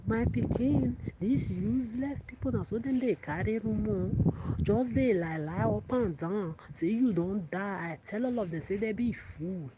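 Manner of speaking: imitation of kitchen woman. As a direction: act out the cook dismissing the rumour-mongers. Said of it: "This is how she usually talks".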